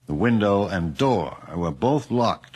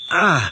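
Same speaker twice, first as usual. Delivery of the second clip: sudden loud high cry of fright; a sharp jump-scare shout; startled yelp; 9 semitones higher, 6 dB louder than usual. Acted. Detective suddenly frightened in the haunted house, loud startled cry.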